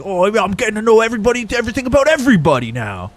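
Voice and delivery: high, loud mock voice; mocking the chatter. Mocking a chatter's nosiness, high mock voice. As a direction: put on a silly nosy voice. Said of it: "He's like".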